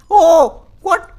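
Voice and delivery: shocked yelp. Real, not acted. Car suddenly vanished.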